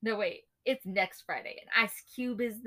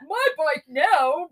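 slasher villain voice; 3 semitones higher, 8 dB louder, much slower than usual. Retold or imitated. Voicing Jason on bike.

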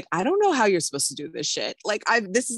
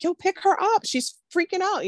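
retold urge to make him act; urgent plea; 13 semitones higher than usual. Mom retelling what she wanted to tell the dad, high urgent plea. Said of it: "I would just wanted to tell him".

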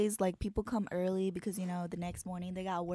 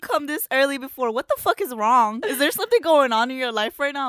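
surprised manager; 7 semitones higher, 12 dB louder than usual. Retold or imitated. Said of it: "the manager was like".

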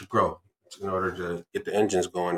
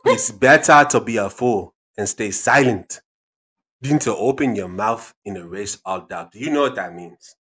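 put-on African accent; 5 dB louder than usual. Dad repeats his quote to gigglers, African accent. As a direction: hammer it home in an African accent. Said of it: "African accent".

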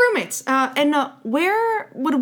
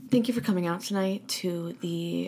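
soft, low mock show opener; hushed stage welcome; 7 semitones lower, 10 dB quieter than usual. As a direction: greet the audience very quietly. Acts improv performers greeting audience.

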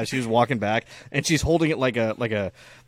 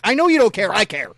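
loud retort; 8 semitones higher, 8 dB louder than usual. Retold or imitated. Dad retorting to daughter.

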